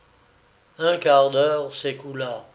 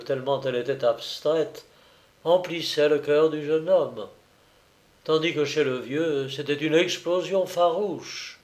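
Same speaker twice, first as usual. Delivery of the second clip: usual speaking voice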